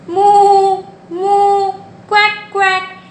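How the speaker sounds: playful animal imitations; cow and duck sounds. Shown as a demonstration. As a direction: belt out the cow then duck.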